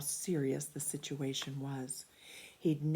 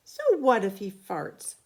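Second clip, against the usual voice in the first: louder, carefree shrug; defiant child; 4 semitones higher, 9 dB louder than usual. A narrator's reading. Billy to Betty alone in their room, defiant. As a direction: brush it off with a shrug.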